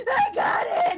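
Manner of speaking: high, loud, thrilled yell; excited shout. Retold or imitated. Runs next door to best friend, shouting excitedly. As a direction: shriek the good news.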